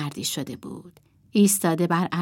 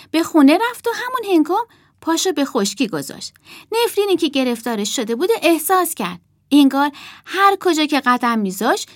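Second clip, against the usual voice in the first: quick, raised, earnest storytelling; serious tone; 7 semitones higher, 3 dB louder than usual. A narrator's reading. Emma telling the puppet audience the old man's fate, serious and urgent. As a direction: narrate gravely but with urgency, pitched up. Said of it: "با لحنی جدی گفت".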